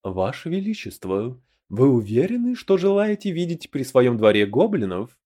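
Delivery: usual speaking voice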